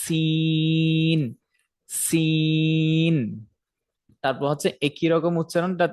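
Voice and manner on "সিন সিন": সিন very slow, s through a small gap in the teeth; very slow, drawn-out সিন; sin between the teeth. Shown as a demonstration. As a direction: hold সিন long with the tongue near the teeth. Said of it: "দুইটা দাঁতের মাঝখানে হালকা একটু ফাঁকা থাকবে".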